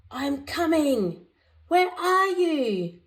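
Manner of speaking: a terrier calling out while searching for Snickers; terrier seeking. A narrator's reading. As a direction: sing it out like playing hide and seek. Terrier hunting in game.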